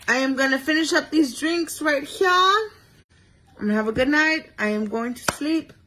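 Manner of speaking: sing-songy tipsy goodbye; giddy tipsy sign-off. Real, not acted.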